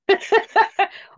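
a sudden loud burst of laughter; big high laugh. Real, not acted. Laughing at her own idea of the role, loud high laugh.

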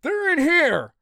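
high, urgent shout of discovery. A narrator's reading. Eagle has found the runaways, shouting. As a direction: shout it out, pitch way up. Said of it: "Eagle shouts".

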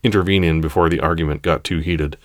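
plain reading voice

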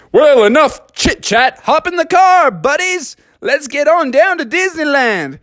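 rally them into the car; eager rallying call. Acted. Calling everyone into the car, eager high rallying.

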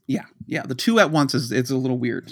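normal talking voice

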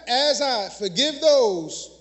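slow, weighted "As I forgive those" with a falling close; slow, deliberate prayer. Shown as a demonstration. Preacher teaching the Lord's Prayer line, slow and weighty. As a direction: linger on "those" to drive it home. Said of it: "Y'all know this prayer?".

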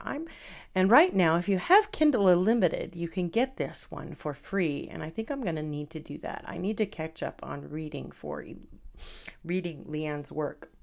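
ordinary delivery

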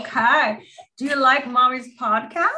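high, sing-song mommy voice; bright and sweet, as if to a toddler; talking to a child. Acted. Mom introduces her daughter to the podcast, high sing-song.

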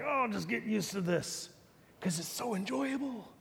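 hushed, self-excusing aside; resigned complacency. Retold or imitated. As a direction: imitate someone quietly settling for it. Voicing complacent adults used to pain.

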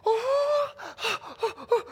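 high squealing oh's; excited gasps. Retold or imitated. Girl opening her Christmas present, squealing excitedly. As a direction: gasp with rising excitement. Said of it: "She's like".

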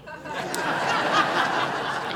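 soft unvoiced laugh; breathy laugh. Real, not acted. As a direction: laugh quietly to yourself. Laughing at own story.

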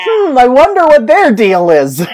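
high, loud, sarcastic wondering; thinking hmm. Acted. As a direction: pretend to ponder, dripping irony. Mock pondering about the villains.

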